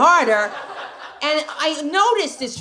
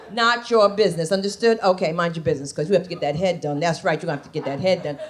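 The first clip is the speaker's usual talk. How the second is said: sassy mother; 7 semitones lower than usual. Retold or imitated. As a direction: snap it at the kid, low and sassy. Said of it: "the snap was out".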